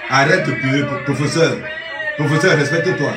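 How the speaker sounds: high, loud exasperated shouting; loud scolding. Acted. Yelling at the professor to respect himself, loud. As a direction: shout him down, fed up.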